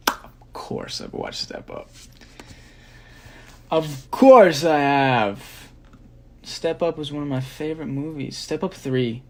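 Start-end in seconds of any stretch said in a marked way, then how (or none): 0.1-1.9 slow, breath-only whisper; soft, slow whispered "of course"; hushed disbelief. Acted. Mock-offended answer to a fan question. 3.7-5.7 loud, drawn-out "of course"; loud and stretched for mock outrage; emphatic exclamation. Same answer repeated emphatically.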